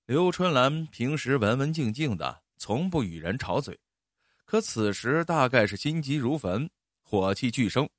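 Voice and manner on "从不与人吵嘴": ordinary delivery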